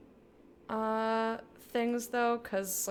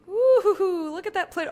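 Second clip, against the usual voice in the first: excited whoop; 9 semitones higher, 10 dB louder than usual. Real, not acted.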